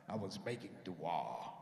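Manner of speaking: very low, slow, solemn answer. Retold or imitated. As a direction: intone it deep and drawn out. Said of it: "That's the way I said it".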